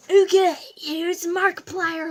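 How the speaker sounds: loud, eager set-up before the impression; hyped intro. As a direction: announce the bit with energy. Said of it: "I'm going to do my impression".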